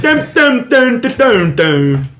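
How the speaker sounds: high plucked "dun" syllables; sung-out banjo sounds, dropping at the end; banjo imitation. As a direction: twang out nonsense notes like a banjo. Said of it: "best banjo impression I've ever heard".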